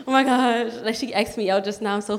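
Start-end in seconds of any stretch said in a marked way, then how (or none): 0.1-0.7 thrilled gush, louder, with a smile; smiling, delighted. Retold or imitated. Teller reliving being asked out by her crush, delighted. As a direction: gush it like a happy secret. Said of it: "just smiling like".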